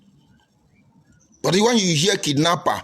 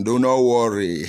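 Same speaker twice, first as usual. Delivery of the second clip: normal talking voice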